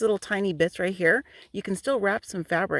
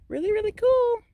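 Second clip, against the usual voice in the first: very high, held-out delight; high-pitched little cheer; delighted singsong; 4 dB louder than usual. Real, not acted. Delighted sign-off on her glass finds.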